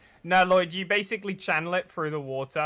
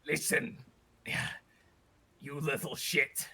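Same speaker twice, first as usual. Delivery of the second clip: fading whisper; 2 semitones lower, 8 dB quieter than usual. Acted. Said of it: "he goes".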